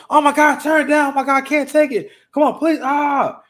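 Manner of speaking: high, loud hammed-up mock tantrum; irate outburst. Woman begging for loud music to be turned down, mock-irate. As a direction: imitate her exasperated, overblown pleading, high and loud.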